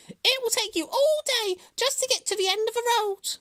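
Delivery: very high, loud hare voice; high-pitched dismissive squeal; scornful hare. A narrator's reading.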